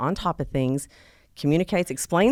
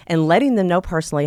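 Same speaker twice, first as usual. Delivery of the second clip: ordinary talk, nothing marked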